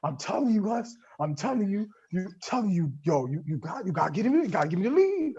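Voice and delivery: high-pitched stammering insistence, climbing at the end; insistent teammate. Retold or imitated. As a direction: imitate a rival demanding his head start. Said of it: "he's like".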